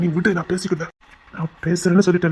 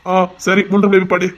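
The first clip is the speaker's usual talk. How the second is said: louder, commanding uncle's voice; elder uncle voice; 6 dB louder than usual. Pretend. Asked to repeat it, sister mimics elder uncle's order, louder. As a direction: boom it out like the big uncle ordering you. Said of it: "மறுபடியும் அதே மாதிரி பேசு பாப்போம்".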